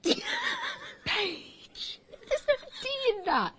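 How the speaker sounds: high-pitched, indignant denial; indignant protest. Real, not acted. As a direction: insist way up high. Defends own pronunciation again.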